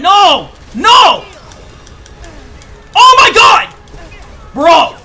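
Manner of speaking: high, loud screams of dismay; panicked shouting. Real, not acted. Character dying in fight.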